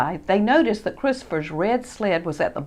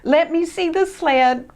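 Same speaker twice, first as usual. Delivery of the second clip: higher, louder firm demand; 10 semitones higher, 4 dB louder than usual. Acted. Christopher demanding to see his sled. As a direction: insist on seeing it. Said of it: "demanded Christopher".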